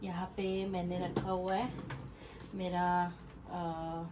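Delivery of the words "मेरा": ordinary talk, nothing marked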